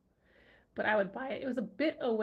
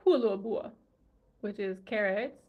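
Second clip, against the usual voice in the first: usual speaking voice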